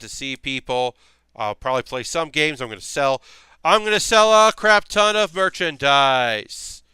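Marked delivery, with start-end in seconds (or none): 3.6-6.8 slow, loud, higher salesman pitch; sleazy salesman. Shown as a demonstration. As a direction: hype it up like a pushy salesman. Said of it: "Get my salesman voice ready to go".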